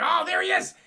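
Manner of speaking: high, loud gleeful call; mock excited shout. Mysterio spotting Spider-Man, loud gleeful call. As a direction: call out as you spot him.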